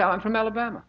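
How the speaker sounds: high-pitched claim to be from Alabama; joking Alabama claim. Acted. Answering someone who asks about the accent, joking Alabama claim. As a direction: say you're from Alabama, pitched well up. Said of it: "I like that accent".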